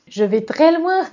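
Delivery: loud, high, laughing; laughing admission. Real, not acted. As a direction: own it with a big laugh. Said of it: "je vais peut-être un petit peu loin".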